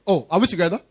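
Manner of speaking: ordinary delivery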